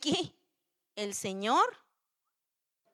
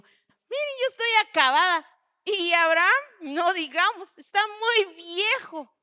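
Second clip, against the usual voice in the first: Sara complaining; 5 semitones higher, 5 dB louder than usual. Retold or imitated. Sara voiced again.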